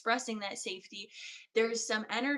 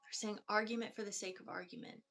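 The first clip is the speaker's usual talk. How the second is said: hushed guided healing; 6 dB quieter than usual. Acted. Healer guiding a client through chakras, hushed meditative voice. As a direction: guide the listener down through each chakra, barely above a murmur.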